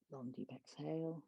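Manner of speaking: calm, measured breathing instructions; guided breathwork cues. Acted. Yoga teacher guiding the class through body locks, calm cues. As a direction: talk the class through each breath hold.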